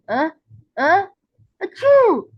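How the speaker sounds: a building stammer that bursts into a loud, high achoo; big sneeze. A narrator's reading. SpongeBob sneezing while trying to seem fine, loud sneeze. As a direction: hold back the sneeze, then let it explode.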